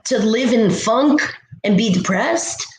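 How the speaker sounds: ordinary delivery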